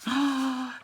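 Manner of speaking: gasp, then a high drawn-out flourish; ta-da reveal. Real, not acted. Crafter unveils her work to viewers, ta-da reveal. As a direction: present it like a little magic trick.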